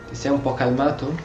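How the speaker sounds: normal talking voice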